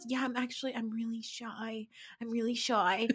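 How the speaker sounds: hushed sarcastic imitation of Ramona; mock shy voice. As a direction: mimic a fake-shy confession, barely audible. Mocking Ramona's fake shyness.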